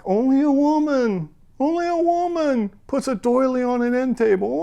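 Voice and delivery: high, crying voice breaking; remembering, near tears; tearful cry. Retold or imitated. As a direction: sob it out, voice up high.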